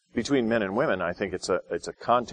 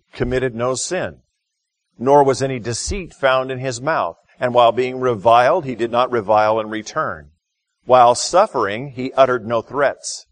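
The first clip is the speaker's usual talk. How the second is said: loud, solemn reading of the passage; scripture reading; 3 semitones higher, 6 dB louder than usual. Acted. Preacher reading First Peter aloud to the church, solemn and loud.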